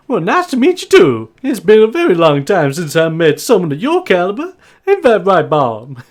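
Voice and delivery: high, loud, gracious farm wife; Maud. Acted. Farm wife welcoming a guest, high and effusive. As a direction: greet the guest in a high, swooping voice. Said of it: "she looks at you and just goes".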